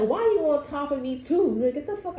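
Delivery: high, exasperated whine; hammed-up outrage; mock protest. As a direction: complain as if pinned down. Retold protest.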